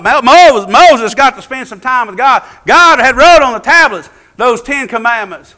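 animated preacher at high pitch; excited preaching. Real, not acted. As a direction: preach it with fervour. Preaches at full force.

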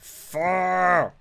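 long, high shouted FOUR; sudden very loud outburst; frustrated yell. Real, not acted. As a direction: bellow the count in frustration. Frustrated recount after miscount.

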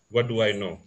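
ordinary talk, nothing marked

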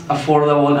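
ordinary talk

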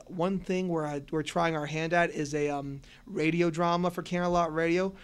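ordinary delivery

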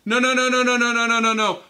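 acted-out urgent objection; rapid-fire protest. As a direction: cut them off with a run of no's. Elijah protests, in a retold scene.

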